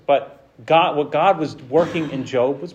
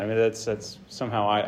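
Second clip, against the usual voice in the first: ordinary delivery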